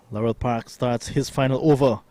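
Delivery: ordinary talk, nothing marked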